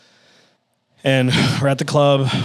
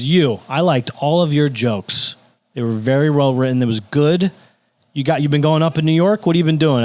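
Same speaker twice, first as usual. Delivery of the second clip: blunt, higher and louder retold verdict; Lisa Lampanelli; 5 semitones higher, 5 dB louder than usual. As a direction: say it like it is, pressing the young comic. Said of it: "she says it like it is".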